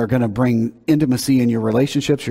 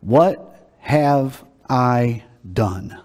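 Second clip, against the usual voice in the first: very slow, one word at a time; donkey's question; 2 semitones lower, much slower than usual. Acted. Donkey to the prophet Balaam, slow deliberate question. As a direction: space out each word with long pauses. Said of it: "and the donkey says".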